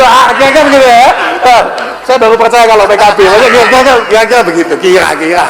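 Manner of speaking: mock the critic's hedging tone; mocking imitation. Preacher mocking a critic, sneering put-on voice.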